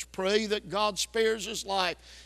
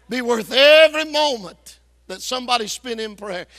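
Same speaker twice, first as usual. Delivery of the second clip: much louder, leaning on every; emphatic preaching; 3 semitones higher, 10 dB louder than usual. Real, not acted. Preacher urging the church on prayer, loud and emphatic. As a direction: drive the line home to the back row.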